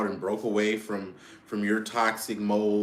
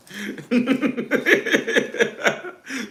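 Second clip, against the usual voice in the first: loud, high-pitched laugh; high laughter; 14 semitones higher, 5 dB louder than usual. Real, not acted. After doing Trina's voice, high laughter. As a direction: crack up at herself.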